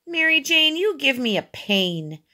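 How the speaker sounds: high start falling back in disgust; fed up. A narrator's reading. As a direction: complain in exasperation. Boy fed up with girl.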